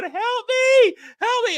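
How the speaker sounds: high mock panic cry, help me; shrill-free but high pleading yell; retold cry for help. Cohost retelling a nightmare, crying out high for help. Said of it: "I'm going".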